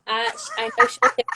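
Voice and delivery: ordinary talk, nothing marked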